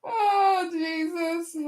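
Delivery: wheezing with laughter; crying laughing. Real, not acted. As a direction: laugh till the eyes water. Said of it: "I got tears in my eyes".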